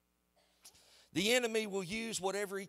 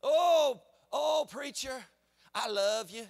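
fawning church member; 4 semitones higher, 5 dB louder, slower than usual. Retold or imitated.

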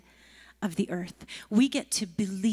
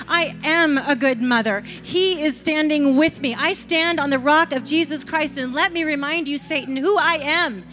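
defiant declaration; 3 semitones higher, 6 dB louder than usual. Pretend. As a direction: shout it down with full conviction. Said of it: "recognize that voice and go".

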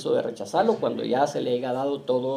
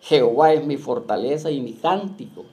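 loud, declaiming preacher reading scripture; 4 dB louder than usual. Acted. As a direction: proclaim the verse to the congregation. Preacher reads scripture aloud. (lectura bíblica proclamada, fuerte)

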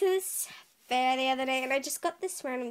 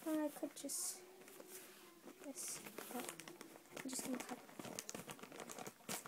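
whisper; 3 semitones higher, 14 dB quieter than usual. Real, not acted. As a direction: murmur under your breath while focusing. Concentrating while cutting package.

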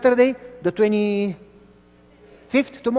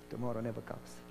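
quiet punchline; 9 semitones lower, 18 dB quieter, faster than usual. Retold or imitated. Vendor's punchline.

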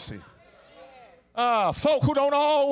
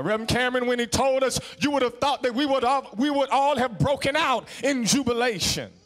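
normal talking voice

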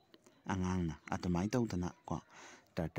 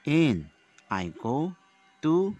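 put-on loud, slow recitation for young pupils; declaimed classroom poem, each phrase set apart; 3 semitones higher, 9 dB louder, slower than usual. Shown as a demonstration. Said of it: "Say the poem aloud with accents".

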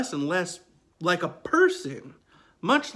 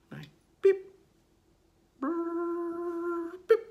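phone beep imitation; 13 semitones higher, much slower than usual. Imitating dead phone line.

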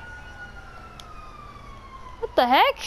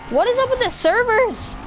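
high exasperated question with voice cracking; 9 semitones higher than usual. Real, not acted. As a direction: voice breaks on up. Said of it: "Uh, voice crack much".

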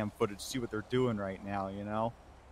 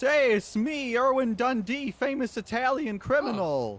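high, put-on Italian mobster accent; a much higher, cartoonish Italian accent; 7 dB louder, much slower than usual. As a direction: ham up a fake Italian gangster. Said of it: "can you give us your best Italian accent".

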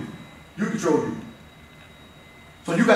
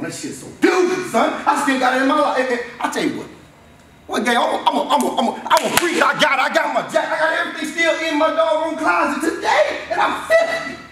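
proud and excited; 7 semitones higher, 3 dB louder than usual. Real, not acted. Proud memory of his glory days.

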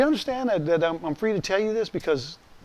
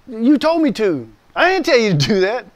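mock exasperated exchange; 6 semitones higher, 6 dB louder than usual. Quarrel between Marine and pastor.

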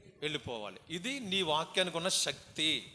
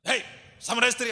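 loud, higher, rough hail; harsh call; 6 semitones higher, 10 dB louder than usual. Shown as a demonstration. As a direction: shout at her the way Jesus did not. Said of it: "గట్టిగా మాట్లాడేవారండి". (గట్టిగా అరిచే పిలుపు)

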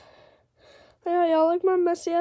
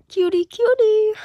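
cooing; 5 semitones higher, 11 dB louder than usual. Real, not acted. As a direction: coo at the cat, pitched up. Cooing at her cat.

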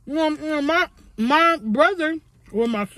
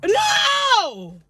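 shouting no; 12 semitones higher than usual. Acted. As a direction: yell it in outrage. Shouts at being woken.

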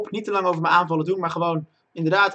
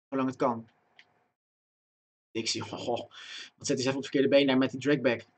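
ordinary talk, nothing marked

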